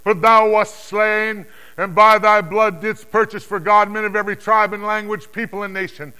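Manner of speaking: high, loud declaimed anthem; triumphant, forceful acclamation; exultant hymn of praise. Acted. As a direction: proclaim it as a soaring song of worship. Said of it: "they were singing a new song".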